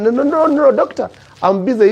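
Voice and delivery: high rapid string of protests; acted busy husband fending off a doctor; hurried refusal. Husband brushes off doctor on call.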